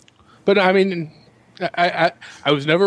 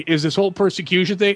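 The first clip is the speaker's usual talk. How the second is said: ordinary talk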